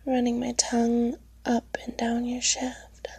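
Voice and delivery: breathy close-mic whisper. Acted.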